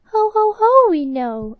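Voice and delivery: high, loud ho ho ho, we know; triumphant mouse laugh. Acted. Newcomer mice tell the queen they know, laughing loud and high. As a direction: boast it in a big high laugh.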